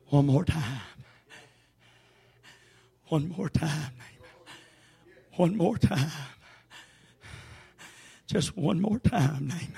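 quiet echo of the cry; hushed refrain. Acted. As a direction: pull it down to a near hush.

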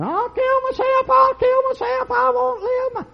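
high shrieking wail, slow and drawn out; hammed-up hysterical woman wailing; mimicking her hysterical screaming. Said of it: "She was running up and down the hall screaming".

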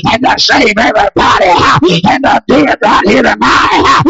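rising, shouted revival preaching; hoarse-edged revival shout cadence; full-force preaching. Acted. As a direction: preach it hard and build the crowd up. Preacher builds to full cry.

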